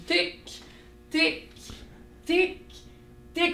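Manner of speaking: high, even tic on each beat; steady beat. Shown as a demonstration. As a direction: tap out the pulse with the voice. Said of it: "On sort nos petits moteurs". (tic sur chaque temps)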